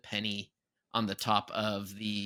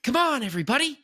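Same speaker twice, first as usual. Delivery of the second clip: Peter Pan ride voice; 5 dB louder than usual. Retold or imitated. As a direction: sing it out like the ride's Peter Pan. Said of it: "it sounds so good with the".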